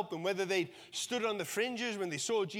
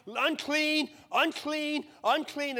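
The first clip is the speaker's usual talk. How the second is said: crying out; 5 semitones higher, 4 dB louder, much slower than usual. Retold or imitated. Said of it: "you'd have to cry out".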